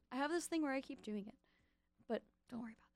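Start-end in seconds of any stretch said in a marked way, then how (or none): 2.1-2.9 whispered, half to herself. Real, not acted. DJ brushing off her accent habit in a whisper. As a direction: let it slip out as a sheepish whisper.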